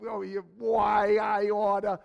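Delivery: hammed-up comic grumbling threat; playful imitation of someone riled; mock threat. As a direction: pretend to be spoiling for a fight. Acts out someone spoiling for fight.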